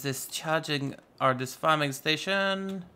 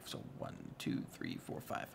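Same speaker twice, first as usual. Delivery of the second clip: whispered count; 15 dB quieter than usual. Real, not acted. To himself, recounting blocks, whispered.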